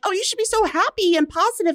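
high, fast, sugary mock of well-meaning people; mocking cheerful advice. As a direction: parody the people who tell her to be positive. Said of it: "Everyone's like".

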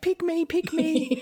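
high, slow, eager pleading with a laugh; eager pick-me plea. Pretend. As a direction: beg to be chosen like a hopeful lotto player. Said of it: "It's like".

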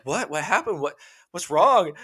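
high, anxious imitation of his wife; worried wife. Husband imitates his worried wife asking what happened. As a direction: mimic the wife asking in alarm.

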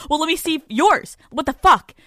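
high, loud mock outrage; mock outraged. Boyfriend demanding to see her photos, mock outrage. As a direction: throw it back, indignant.

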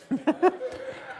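quiet high-pitched chuckle; a short high giggle; amused soft high laugh. Real, not acted. Laughing at own memory.

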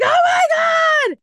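high, slow, loud gasp of shock; mother floored by the news; shocked shout. Retold or imitated. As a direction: mom shrieking in disbelief. Mother hears baby is born.

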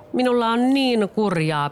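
high, slow whimpering whine; a puppet wailing to win pity; whining complaint. A narrator's reading. Pinocchio whines to villagers, slow high wail. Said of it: "Pinokkio valitteli".

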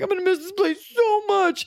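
very high, gushing mock wail; mock sentimental. Leaving an old apartment, exaggerated mock heartbreak. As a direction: overdo a pining goodbye.

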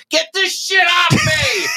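high-pitched mock scream from the back seat; imitated panicked yell. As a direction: yell it like a disgusted passenger. Retold panicked woman in back seat.

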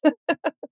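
a short high laugh at herself; self-mocking laugh. Real, not acted. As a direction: laugh at your own folly.

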